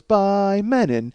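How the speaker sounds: slow, drawn-out ad tagline; commercial tagline. Retold or imitated. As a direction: stretch it like the end of a TV ad. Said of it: "at the end of a Speed Stick commercial it goes".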